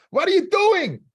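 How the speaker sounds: high, loud, frantic shriek at the husband; wife screaming. Retold or imitated. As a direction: scream it like a wife who found him missing from the store. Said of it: "Starts screaming at him".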